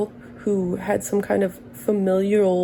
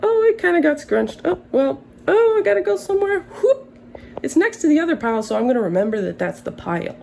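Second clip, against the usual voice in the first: mock flustered self; 7 semitones higher than usual. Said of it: "Two days later".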